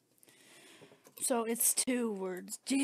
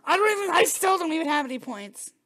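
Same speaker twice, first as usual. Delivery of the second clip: frustrated outburst; 4 semitones higher, 7 dB louder, faster than usual. Real, not acted. Frustrated at having no points.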